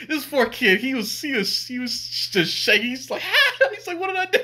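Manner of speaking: high-pitched telling through real laughter; barely holding the laugh in; laughing hard. Real, not acted. Laughs, mimics frightened kid.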